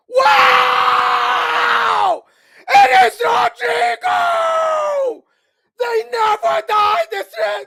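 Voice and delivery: high, very loud goal-call scream with stretched vowels; real excited yelling. Real, not acted. Commentator screams at Rodrigo's late goal, excited yelling. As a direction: erupt in astonishment at the goal. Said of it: "I yelled too loud".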